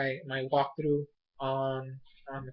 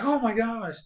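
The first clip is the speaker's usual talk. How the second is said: imitated amazement; 7 semitones higher than usual. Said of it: "he's like".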